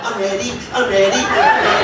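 squeaky SpongeBob impression rising to a squeal. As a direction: do SpongeBob's eager cry. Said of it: "I had to turn into SpongeBob".

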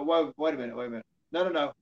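ordinary talk

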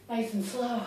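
usual speaking voice

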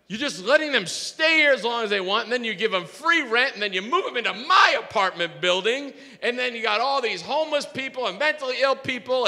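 high, indignant complaint that keeps mounting; angry rant. Retold or imitated. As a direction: imitate an aggrieved man piling up grievances. Acted neighbour's angry rant.

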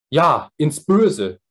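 Ja, ins Böse laut und langsam herausrufen; hoch und laut, jedes Wort gedehnt; laut rufend. Acted. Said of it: "rief der Jüngling". (ein erregter Ausruf, laut und langsam)

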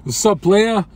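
high, loud mock gangster greeting; mocking gangster talk. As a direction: make fun of a chatter's gangster slang, loudly. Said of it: "making fun of you losers".

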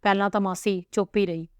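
ordinary delivery

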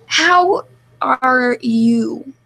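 laboriously spelled-out show of asking; exaggerated asking. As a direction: stretch every word out for emphasis. Mock-earnest question demanded by the group.

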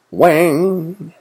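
high, ringing "wang"; imitated impact noise. A narrator's reading. Book thrown at an owl, voiced impact noise. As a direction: twang it high like a clang.

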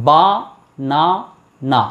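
বা - না - না in three separate beats; even pauses between বা, না and না; syllable by syllable. Shown as a demonstration. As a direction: sound out বানানা one syllable at a time. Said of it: "তিনবার stroke করছে".

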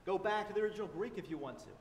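ordinary talk

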